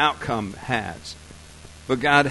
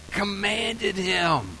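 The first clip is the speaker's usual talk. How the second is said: higher, long drawn-out syllables; mock dreamy; 5 semitones higher, much slower than usual. Shown as a demonstration. Preacher parodying a too-gentle command, slow and dreamy. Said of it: "Does commanded sound like violins playing".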